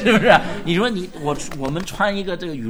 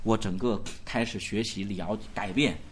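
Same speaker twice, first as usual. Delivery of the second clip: much lower and softer, humble telling; a touch of humble testimony; lowered quote; 9 semitones lower, 8 dB quieter than usual. Pretend. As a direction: say it low, almost to yourself. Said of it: "他说".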